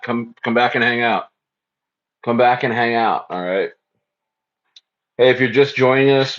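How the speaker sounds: usual speaking voice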